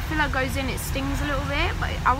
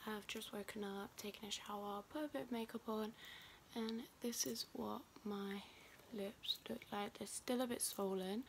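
quiet morning voice; 19 dB quieter than usual. Real, not acted. Quiet morning vlog, half whispered.

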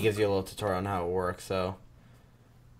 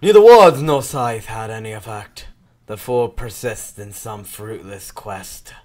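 villain voice-over; 10 dB louder than usual. Acted. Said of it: "I'll, I'll do this one".

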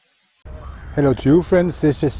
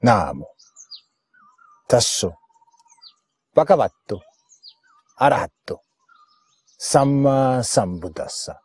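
solemn homage recitation. Acted. Paying homage to the blessed Buddha, solemn recitation. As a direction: intone each word separately, letting it sink.

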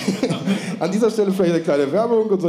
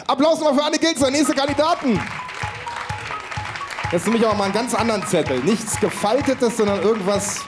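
game show host; 6 semitones higher than usual. Acted. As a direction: hype up the next contestants. Host calls next contestants.

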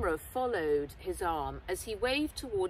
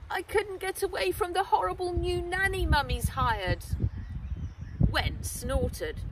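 put-on higher, louder aggrieved boy's voice; indignant boy; 7 semitones higher, 7 dB louder than usual. Henry telling Wen about the horrible nanny, aggrieved boy. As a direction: complain hotly like a hard-done-by kid.

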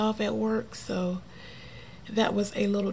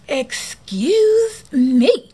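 slow, louder, higher mock-rude excuse me; snippy lady; 5 semitones higher, 7 dB louder, much slower than usual. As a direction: mimic the rude lady's stretched-out retort. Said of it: "she turns around and she goes".

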